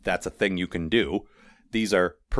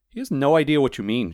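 raised-pitch explanation; higher character voice, mildly pointed; explaining character; 6 semitones higher, 4 dB louder than usual. A narrator's reading. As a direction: put the listener straight in a higher voice. Miss Deerheart explains.

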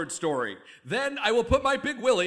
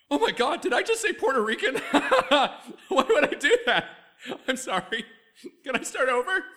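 mortified laughing; 6 semitones higher, 5 dB louder than usual. Acted. Horrified at his own slip.

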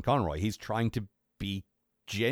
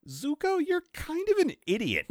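mocking high voice. Mocking the villain.

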